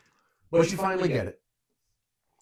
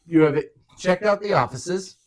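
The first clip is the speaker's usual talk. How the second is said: game master narration; 10 semitones higher, 12 dB louder than usual. Acted. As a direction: set the scene for the players. GM sets the scene.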